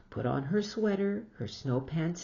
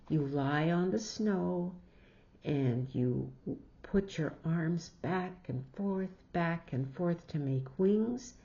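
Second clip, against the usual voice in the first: usual speaking voice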